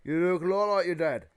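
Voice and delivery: loud, high giant exclamation; hearty giant. Acted. Giant seeing Harry's likeness to his dad, hearty. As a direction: exclaim over the family likeness.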